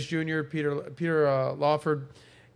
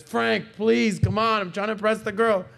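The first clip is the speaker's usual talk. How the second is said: higher, mock-whiny begging; pleading Don; 5 semitones higher, 4 dB louder than usual. Don begging Frank for a favor, mock pleading. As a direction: beg your friend like it's urgent. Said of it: "He goes".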